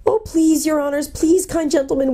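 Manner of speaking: high, pleading gnome voice; whimpering. A narrator's reading. Gnome pleads with his captors, whimpering. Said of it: "whimpered the gnome".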